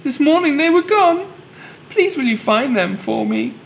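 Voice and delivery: high, hammed-up tearful plea; crying. Helen begging Daniel to find her eggs, high mock-tearful voice. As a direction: go high and plead in a storybook cry. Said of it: "she cried".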